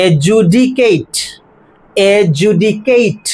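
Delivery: slow এজুডিকেট, "a-ju-di-KATE", no first d; correct pronunciation. Shown as a demonstration. Teacher modelling adjudicate without d, correct pronunciation. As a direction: draw out এজুডিকেট syllable by syllable, ending "kate". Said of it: "ডি বাদ গেলে কি হবে?".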